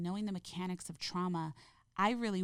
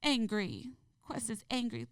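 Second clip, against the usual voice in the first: put-on higher little-child voice, short phrases; toddler narrating emotions; 5 semitones higher, slower than usual. Mother imitating her son naming his emotions, lightly voiced toddler. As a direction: mimic a small child announcing feelings. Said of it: "he even narrates, um, his emotions".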